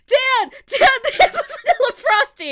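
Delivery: high, loud excited news; excited call. Retold or imitated. Excitedly telling dad on the phone about vanilla Frosties.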